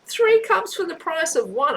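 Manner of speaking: high, far-off tempting voice, raising pitch on Three; a devil offering a bargain too good to refuse; mock devil sales pitch. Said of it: "he's telling me he'll give me three cups".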